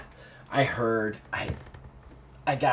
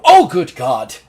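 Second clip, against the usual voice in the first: startled exclamation; 2 semitones higher, 13 dB louder, slower than usual. Acted. NPC startled at the door.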